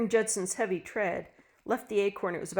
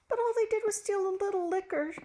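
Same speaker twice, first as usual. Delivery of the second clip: high pleading wife's voice; 13 semitones higher than usual. A narrator's reading. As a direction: plead for mercy for them. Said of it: "she pleaded".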